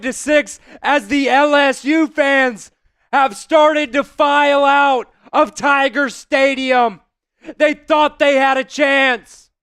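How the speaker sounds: fast, loud touchdown call, like a stadium announcer; excited play-by-play. Acted. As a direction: call the run live, building to the score. Live play-by-play of touchdown run.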